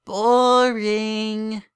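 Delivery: put-on high, loud childish verdict; a little filly declaring it dull; bored filly. A narrator's reading. Twilight tells her foal-sitter the story was dull, bored.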